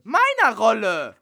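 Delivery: indignant actress screaming for her part; furious yelling. Acted. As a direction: yell at him like you've been betrayed. Bella insists on her role.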